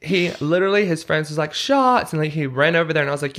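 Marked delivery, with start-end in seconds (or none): 1.5-2.1 called out. Retold or imitated. Friends calling for shots.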